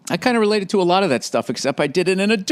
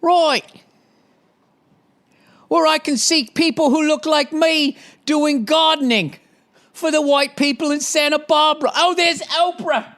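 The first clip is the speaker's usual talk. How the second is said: hammed-up English voice pitched way up; English accent; 10 semitones higher than usual. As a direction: draw it out in a silly high English accent. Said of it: "hearing that stupid accent".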